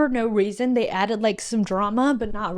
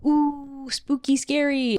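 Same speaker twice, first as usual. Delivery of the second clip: higher, mock-scared ooh; mock spooky; 4 semitones higher, slower than usual. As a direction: play at being spooked. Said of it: "I wasn't like".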